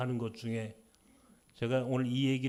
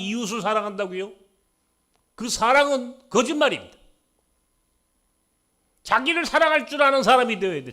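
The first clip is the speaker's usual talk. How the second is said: loud, high, impassioned sermon; raised fervent preacher's voice; preaching at full force; 8 semitones higher, 12 dB louder than usual. Acted. Pastor preaching to congregation.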